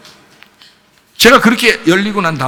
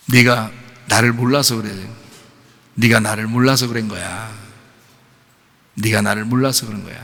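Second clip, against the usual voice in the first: softer, gentle voice of the Lord consoling; tender Lord; 5 semitones lower, 6 dB quieter than usual. Retold or imitated. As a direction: comfort a weeping child, hushed. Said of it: "주님이 나한테 그래요". (우는 나를 달래시는 주님의 부드러운 목소리)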